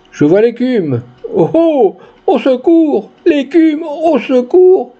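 high-pitched frantic cry for help, stretching at the end; panicked cry. A narrator's reading. Bernard calls for help against the dog, panicked. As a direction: cry out in terror, pitch climbing. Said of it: "s'écria monsieur Bernard, devenu tout pâle".

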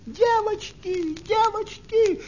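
very high, drawn-out squeal for help; thin-volume, high-pitched wail; mouse crying out. A narrator's reading. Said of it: "закричала мышка".

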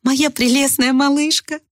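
high, slow, adoring mum; doting mother. A narrator's reading. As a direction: gush over your little girl. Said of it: "а мать произнесла".

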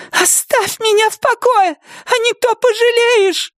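very high, thin, shrieking; shrill cry. Acted. Nettie warning Wilma, shrill thin cry. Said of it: "визгливо выкрикнула она своим тонким дрожащим голосом".

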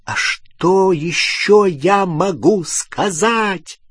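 high, drawn-out exasperated cry; yelling back. A narrator's reading. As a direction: yell back helplessly, stretching the words. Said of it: "заорал Рон".

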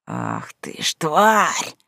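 slow rasping snarl; hoarse rasp. A narrator's reading. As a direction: croak it out hoarsely. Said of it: "прохрипело где-то за спиной".

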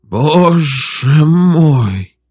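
long-drawn, rattling lament; quavering voice. A narrator's reading. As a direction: stretch each word out, voice quavering. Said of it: "дребезжащим голосом проговорил Иван Иванович".

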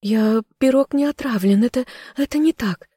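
high, frightened maid's voice; squirming denial. A narrator's reading. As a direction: stammer a desperate excuse. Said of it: "вновь попыталась выкрутиться служанка".

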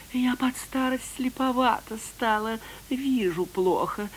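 put-on high, frail, pitiful old voice; feeble old fox voice. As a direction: play a sly fox faking frailty. Fox feigns being old, blind.

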